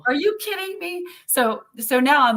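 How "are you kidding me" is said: stunned friend, quoted in a raised pitch; retold disbelief. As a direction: imitate a friend's high-pitched incredulous question. Retelling friend's stunned reaction.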